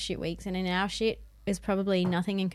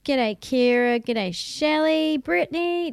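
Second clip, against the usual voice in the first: higher, stretched sing-song hellos; sing-song greetings; 6 semitones higher, 5 dB louder, slower than usual. Pretend. Greeting each live listener by name, sing-song and high.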